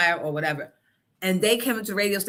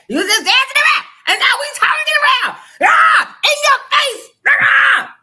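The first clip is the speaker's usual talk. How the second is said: high, loud laughing taunt; mother gloating in son's room, screaming high; 12 dB louder than usual. Retold or imitated. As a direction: scream it in his face. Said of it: "went in his room screaming".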